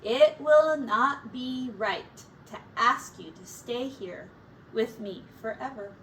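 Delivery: usual speaking voice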